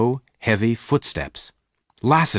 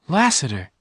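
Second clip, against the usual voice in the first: higher, urgent call of his name; crying out; 7 semitones higher than usual. A narrator's reading. Jane crying out to Lassiter. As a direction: call out to stop him. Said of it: "Jane cried".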